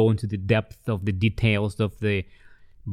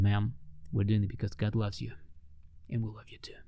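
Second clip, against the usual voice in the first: much softer, trailing into a whisper; tender whisper; 8 dB quieter than usual. Retold or imitated. He tells the lady God loves her, in a soft whisper. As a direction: say it gently, almost breathless.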